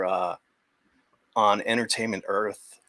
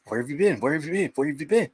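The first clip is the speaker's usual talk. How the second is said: excited dog; 6 semitones higher, faster than usual. Retold or imitated. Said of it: "they'll be like".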